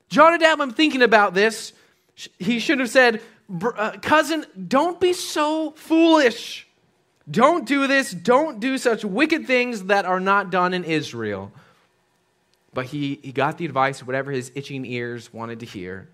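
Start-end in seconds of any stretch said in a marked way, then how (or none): 0.1-11.5 high, loud, slow scolding; stern rebuke. Acted. Son rebuking his bad adviser, loudly. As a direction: thunder a warning at the cousin.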